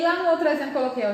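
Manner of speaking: ordinary talk, nothing marked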